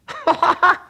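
very high squeaky laugh; griffin laugh. Acted. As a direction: burst out cackling. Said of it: "griffin laughing".

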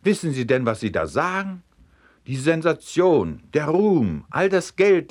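building excitement. A narrator's reading. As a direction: swept up, pressing harder with each phrase. Director urging, building up. (immer höher und lauter, drängend)

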